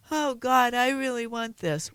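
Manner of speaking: mock whiny pleading child. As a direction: whine it like a begging kid. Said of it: "sounds like a whiny child going".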